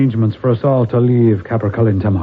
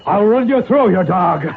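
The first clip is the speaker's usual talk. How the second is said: defiant threat; 6 semitones higher than usual. Acted. Father defies the ghost who came to claim him, rising defiant anger. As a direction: pitch climbing high on the threat, defiant.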